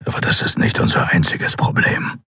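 rough, barely voiced growl. A narrator's reading. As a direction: growl out the warning with little tone. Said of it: "knurrte Keru". (raues, fast tonloses Knurren)

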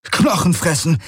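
high-pitched warning call without extra volume; narrated exclamation of alarm; alarmed cry. A narrator's reading. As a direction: cry out the danger, pitch jumping up. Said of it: "rief er aus".

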